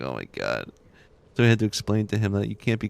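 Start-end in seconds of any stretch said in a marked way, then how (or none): 0.0-0.7 much lower and softer, under the breath; low, quiet dismay, replayed; retold mortified aside. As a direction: drop the voice in embarrassment. Retold embarrassed reaction to child.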